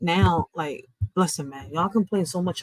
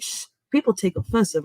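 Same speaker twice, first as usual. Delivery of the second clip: ordinary delivery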